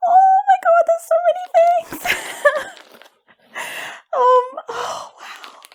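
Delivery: very high, loud excited squeal; overwhelmed squeal. Real, not acted. Unboxing the gift, very high loud squeals. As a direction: squeal with joy at the goodies.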